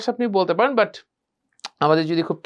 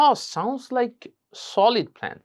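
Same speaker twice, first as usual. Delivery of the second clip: higher, drawn-out admiring "Oh"; appreciative "oh"; 5 semitones higher, much slower than usual. Shown as a demonstration. Teacher models praising a friend's plan, higher and appreciative. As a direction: lead in with an impressed "Oh". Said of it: "এই অ সাউন্ডটা যুক্ত করবো".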